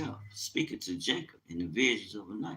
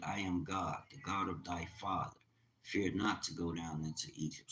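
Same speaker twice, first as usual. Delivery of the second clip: softer, measured Bible reading; quiet solemn scripture voice; 8 dB quieter than usual. Acted. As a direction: read the verse with reverence. Reads scripture aloud.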